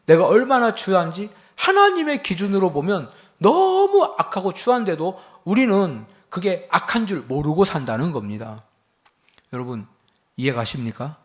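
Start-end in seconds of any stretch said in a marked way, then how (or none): 0.1-8.6 loud, high preaching, pressing each phrase; fiery preaching. Acted. Pastor preaching to his congregation, loud and urgent.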